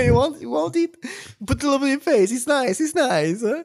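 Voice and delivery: high, loud mock Italian waiter; Italian accent. Playing an Italian waiter offering dishes, mock Italian accent. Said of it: "that was an Italian accent".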